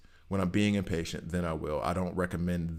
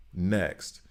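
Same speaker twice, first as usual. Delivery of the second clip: normal talking voice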